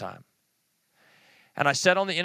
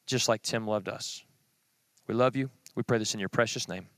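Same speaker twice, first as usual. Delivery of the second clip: prayer; 2 semitones lower than usual. Acted. Closing prayer.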